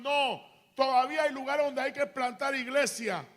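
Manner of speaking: loud, forceful preacher's retelling; emphatic divine answer. Retold or imitated. God answers the preacher's doubt about Panama, loud and emphatic. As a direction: declare it firmly as God's reply.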